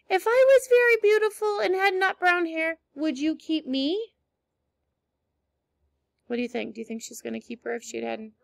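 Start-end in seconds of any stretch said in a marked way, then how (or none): 0.1-4.1 hopeful plea. Acted. Anne pleading to be kept.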